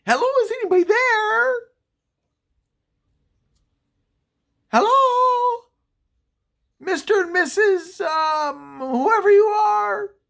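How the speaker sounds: high, loud call into the house. Retold or imitated. Narrator calls into the dark house to check.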